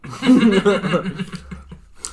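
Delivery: loud burst of laughter; laughing out loud. Real, not acted. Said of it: "You can close your mouth".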